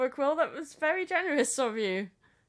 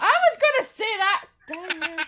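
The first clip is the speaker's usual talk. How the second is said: loud, high outburst; indignant shout; 6 semitones higher, 10 dB louder than usual. Real, not acted. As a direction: blurt it out in protest. Indignant, quote stolen.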